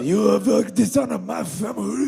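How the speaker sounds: hammed-up Japanese honor accusation; mock Japanese accent. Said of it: "we were doing, like, Japanese".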